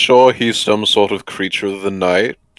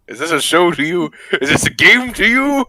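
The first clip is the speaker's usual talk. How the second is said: mock indignant scolding, rising high; mock outrage; 7 semitones higher than usual. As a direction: pretend offense, hammed up. Mock scolding of apple-eating player.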